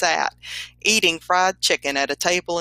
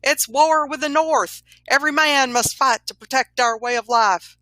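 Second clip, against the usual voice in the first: high, urgent rallying cry; acted shout to rouse men; 8 semitones higher, 3 dB louder than usual. A narrator's reading. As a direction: call it out high like a rider bringing news. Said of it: "on horseback arrived shouting".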